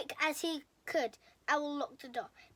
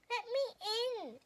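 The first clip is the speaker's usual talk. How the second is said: mouse voice; 11 semitones higher than usual. Acted. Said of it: "called Mouse".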